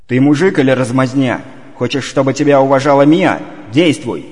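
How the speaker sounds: angry. Acted. Сердито оборвал.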